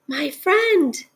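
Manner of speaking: high little voice from far down; small, high storybook character voice; tiny speck voice. A narrator's reading. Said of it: "came the voice".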